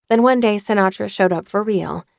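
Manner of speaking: ordinary talk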